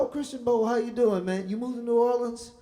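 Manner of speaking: high, loud, overjoyed hello; hammed-up shouting into the phone; mock loud greeting. Teller mimics answering the young singer's call, hammed-up loud. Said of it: "I go".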